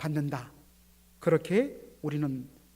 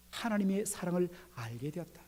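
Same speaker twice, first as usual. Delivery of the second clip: ordinary talk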